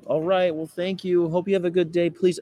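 high, syrupy put-on politeness; laid-on-thick niceness. Activist replying to rude people, laid-on-thick sweet. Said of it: "I'll lay it on thick. I'm like".

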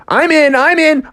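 high, excited volunteering; eager Froggy. Acted. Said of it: "Froggy was like".

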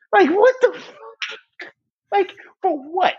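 high, slow outraged disbelief; incredulous. Real, not acted. Podcast host reacting to Harden's quote, high incredulous outburst. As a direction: stretch it out in stunned disbelief.

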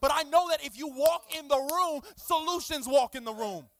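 loud, rising prayer cry; fervent prayer. Acted. Pastor modelling prayer for the church, fervent. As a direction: call out to the Spirit.

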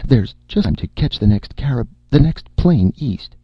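high, loud, stumbling urgency; desperate. A narrator's reading. Erica inventing an excuse to leave, desperately. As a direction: stumble over it in desperate haste. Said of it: "Erica said desperately".

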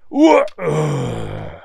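cut-off word sinking into a groan; knocked-out groan. A narrator's reading. Marco hit on the head mid-question, knocked-out groan. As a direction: start to speak, then collapse into a long falling ugh.

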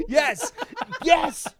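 high, loud laughing agreement; excited yes. Real, not acted. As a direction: burst out in delighted agreement.

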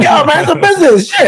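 high-pitched, defensive comeback; squeaky retort to the hecklers; mock protest. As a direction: protest in exaggerated indignation. Acts out his protest to the hecklers.

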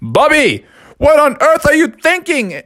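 high, loud shout of alarm; startled father bellowing his son's name; dad screaming. Acted. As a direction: yell at the kid from across the house. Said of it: "his dad screaming".

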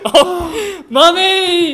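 loud, high cry of Mummy; shocked cry. Acted. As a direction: call out in shock. Children discover dead mother.

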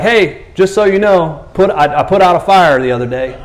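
lightly acted humblebrag; proud announcement. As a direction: chime in, pleased with yourself. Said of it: "he's proud of it".

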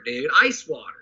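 ordinary delivery

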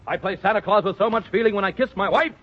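ordinary talk, nothing marked

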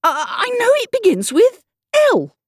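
high, loud, stammering effort; straining to remember. Acted. Alice puzzling over her forgotten name, straining eagerly. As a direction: push the letter out as if it might unlock the rest.

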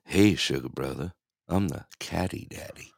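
low, flirty come-on voice; sexy voice. Shown as a demonstration. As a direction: purr it like a flirt. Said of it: "Say, repeat after me".

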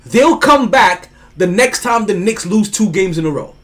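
normal talking voice

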